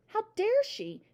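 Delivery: high, indignant outburst. A narrator's reading. Jealous father fuming at his daughter's reading, indignant. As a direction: voice the father's affronted outrage.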